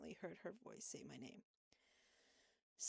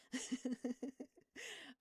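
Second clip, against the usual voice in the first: higher-pitched run of giggles; real giggle; 7 semitones higher, 5 dB louder than usual. Real, not acted. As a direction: giggle at your own muddled description. Laughing at own shelf description.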